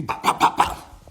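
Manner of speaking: mouthed bop bop of punches landing; loud, breathy imitated blows; retelling the fight with punch noises; punching sounds.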